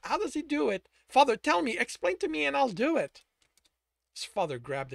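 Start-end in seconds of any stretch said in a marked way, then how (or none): 0.0-3.2 high, louder desperate begging; pleading. A narrator's reading. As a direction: plead with father for help. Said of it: "he pleaded".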